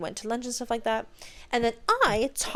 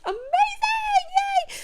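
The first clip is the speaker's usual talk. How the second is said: delighted; much faster than usual. Retold or imitated. Telling how she answered Nick, delighted. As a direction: sing out a gleeful little yay. Acts out her delighted reply.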